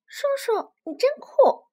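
put-on very high, loud childish delight; overjoyed. A narrator's reading. Little dinosaur admiring uncle who beat the pterosaur, overjoyed. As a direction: squeal it in admiration. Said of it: "臭臭哒哒高兴极了".

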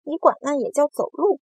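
high, loud challenge; shouted retort. A narrator's reading. Centipede challenging the earthworm's walking, shouted. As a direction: throw the question back loudly. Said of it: "蜈蚣大叫道".

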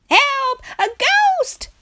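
very high, loud shriek for help; terrified shout. A narrator's reading. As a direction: scream it in high-pitched panic. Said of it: "shouted the three friends".

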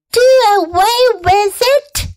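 put-on high shocked shriek of a girl; horrified child's high cry. A narrator's reading. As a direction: shriek it out in disbelief. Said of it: "shrieked Fern".

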